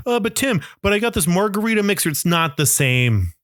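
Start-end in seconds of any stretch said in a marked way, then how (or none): 0.1-2.1 whiny excuse voice. Retold or imitated. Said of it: "And you're like".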